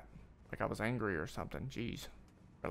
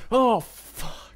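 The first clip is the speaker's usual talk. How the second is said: high, loud startled curse; a sudden loud high curse; shocked shout; 11 semitones higher, 14 dB louder than usual. Real, not acted. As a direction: yelp it out in real shock. Sudden shock at a game death.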